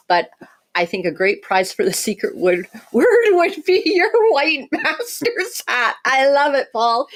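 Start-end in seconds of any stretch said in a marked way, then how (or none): 2.9-7.1 laughing. Real, not acted. Joking about a hat prize.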